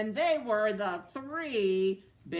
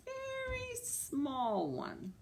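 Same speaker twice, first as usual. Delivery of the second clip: tiny; 5 dB quieter, much slower than usual. Acted. Sizing the three beds for children.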